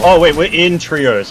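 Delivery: loud, high, slow gasp of realisation; sudden realisation. Real, not acted. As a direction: blurt it out as it dawns. Sudden realisation mid fight.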